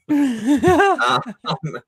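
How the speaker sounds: high, laughing through the word. Real, not acted. Laughing at wrong button mix-up.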